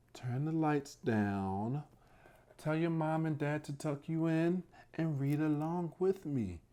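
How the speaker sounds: usual speaking voice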